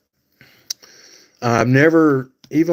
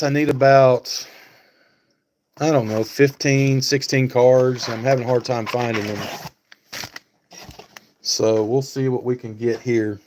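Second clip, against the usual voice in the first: ordinary talk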